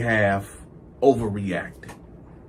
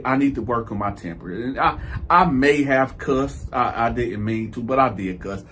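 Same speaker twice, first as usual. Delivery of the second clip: usual speaking voice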